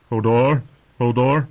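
frightened. A narrator's reading. Hodor hearing of armed men, frightened. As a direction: blurt the name in fright, twice. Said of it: "Hodor sounded frightened".

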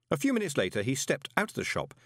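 ordinary delivery

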